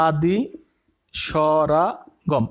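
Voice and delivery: the last word stretched out very slowly; slow dictation. Shown as a demonstration. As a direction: spell it out slowly so students can write. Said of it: "লেখো আদি স্বরাগম।".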